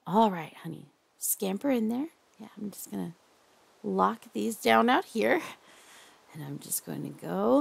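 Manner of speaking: hushed, doting captor who won't let go. Acted. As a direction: lull your captive with creepy tenderness. Holds a soft captor roleplay voice.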